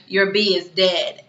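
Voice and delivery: usual speaking voice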